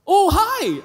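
mock startled recognition; meeting his ex-teacher, a high startled hi; surprised greeting. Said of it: "And I was like".

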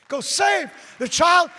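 put-on slow, loud, high shout of "Go save the child". Sergeant shouting the preacher on his way. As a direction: roar "Go save the child" at him. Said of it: "repeated shouted".

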